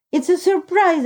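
narrated outburst of excitement; excited cry. A narrator's reading. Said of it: "I cried".

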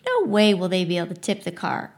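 plain reading voice

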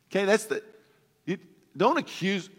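ordinary talk

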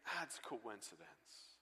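slow, soft, dismissive brush-off; dismissive skeptic. Retold or imitated. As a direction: shrug it off like a doubting skeptic. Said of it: "the skeptic will look at that and say".

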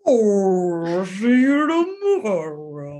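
slow, stretched, laughing words; Dory speaking whale, stretched and slow. Retold or imitated. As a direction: drawl it out like talking whale. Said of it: "trying to talk to the whales".

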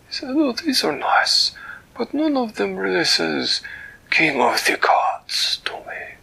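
a delighted, fussy creature greeting a friend; Flibbertigibbet's voice. Acted. Storyteller voicing Flibbertigibbet greeting Winella, high and delighted.

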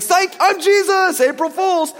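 a goofy high sing-song prank voice; playful Jesus prank. Retold or imitated. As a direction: ham up a teasing gotcha reveal. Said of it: "He's like".

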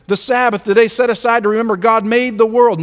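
ordinary talk, nothing marked

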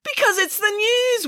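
high, drawn-out exasperated wail; hammed-up helpless protest. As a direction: wail it slowly, at a loss. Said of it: "wailed the news director".

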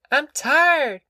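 put-on high, whiny little girl; whining child. A narrator's reading. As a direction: whine it like a worn-out child. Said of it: "she whined".

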